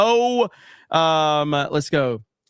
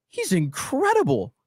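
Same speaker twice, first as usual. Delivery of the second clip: high, slow, drawn-out awe; mock-starstruck rave; retold amazement; 9 semitones higher than usual. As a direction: marvel at it, stretching the praise. Retelling his amazement at preacher.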